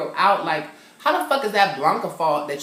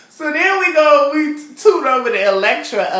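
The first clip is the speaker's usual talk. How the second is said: campy excitement; 8 semitones higher, 9 dB louder than usual. Real, not acted. Campy storytelling burst.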